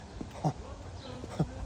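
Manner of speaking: quiet, breath-only chuckle; hushed mock laughter; soft imitated laugh. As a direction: mimic a passenger's wheezy little laugh. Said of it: "he was kinda laughing like".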